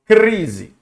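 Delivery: forceful "crise"; emphatic cluster. Shown as a demonstration. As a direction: hit the "cr" of "crise" hard. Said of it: "encontro consonantal".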